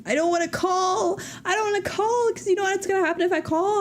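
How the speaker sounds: whiny retold dread. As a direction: whine it like a kid refusing.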